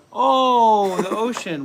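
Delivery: high, loud exaggerated oh; laughing mock gasp; mock surprise. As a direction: fake astonishment at the ocean.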